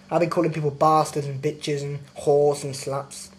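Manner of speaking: ordinary talk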